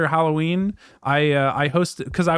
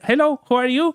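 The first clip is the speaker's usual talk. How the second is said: high, innocent Andy Kaufman greeting; 8 semitones higher than usual. Retold or imitated. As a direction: play the confused stranger, high-pitched. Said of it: "I arrived at the venue as Andy Kaufman".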